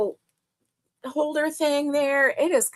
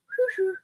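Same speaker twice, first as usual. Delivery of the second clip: high little "Hoo, hoo" whistle call; phone whistle imitation; 9 semitones higher, 3 dB quieter than usual. Host imitating her phone's message whistle, high hoot. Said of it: "it goes".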